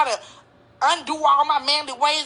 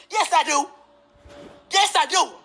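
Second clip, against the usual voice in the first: talking back. Real, not acted. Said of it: "I don't need a man".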